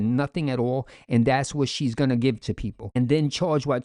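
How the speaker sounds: usual speaking voice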